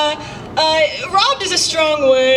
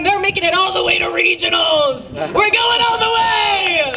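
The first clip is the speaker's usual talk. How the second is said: excited cheering; 6 semitones higher than usual. Acted. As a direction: cheer like a hype coach. Hyped cheering for basketball boys.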